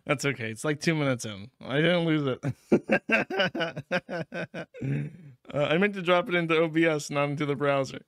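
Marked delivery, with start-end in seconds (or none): 2.4-5.1 laughing it off; real sheepish laugh. Real, not acted. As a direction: chuckle at the mess-up. Laughing at own editing mistake.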